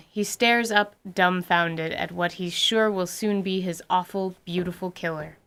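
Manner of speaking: usual speaking voice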